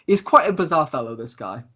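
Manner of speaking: ordinary talk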